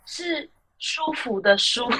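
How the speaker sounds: 是舒服的舒 said very slowly; slow correction. Retold or imitated. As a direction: spell out 是舒服的舒 word by word. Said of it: "不是". (一字一字慢慢纠正)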